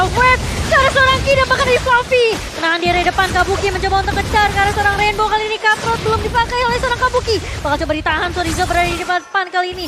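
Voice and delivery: loud, high, fast hype commentary; excited play-by-play. Acted. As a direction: call the teamfight at full volume.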